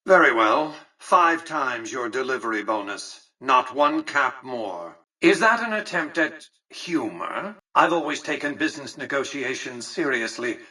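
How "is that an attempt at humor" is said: higher-pitched, disapproving question; affronted disbelief. Acted. Businessman rebuking a joke during negotiations, affronted. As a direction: question the joke with cool disdain, rising at the end.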